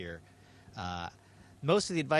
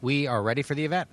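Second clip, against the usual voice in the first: loud reply over radio; sci fi communicator; 4 semitones higher, 8 dB louder, faster than usual. Real, not acted. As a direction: answer through a radio link. Station answers over radio.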